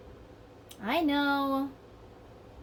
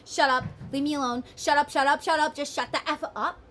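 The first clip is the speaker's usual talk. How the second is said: loud repeated shut up; hands-over-ears shouting; 3 semitones higher, 5 dB louder than usual. Retold or imitated. Both partners blocking out the noise, loud stubborn shouting. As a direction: drown them out, stubborn and loud. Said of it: "putting your hands over your ears".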